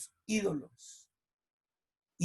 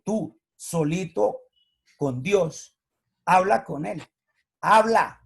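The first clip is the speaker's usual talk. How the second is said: loud, slow, emphatic reading; fervent sermon delivery; preached scripture quote; 4 dB louder than usual. Acted. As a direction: preach it out to the room. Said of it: "el Señor Jesús dijo".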